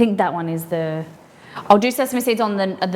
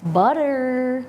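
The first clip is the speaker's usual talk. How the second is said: sing-song; 5 semitones higher than usual. Pretend. Sing-song word to chat.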